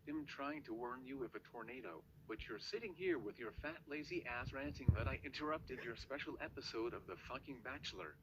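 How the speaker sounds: normal talking voice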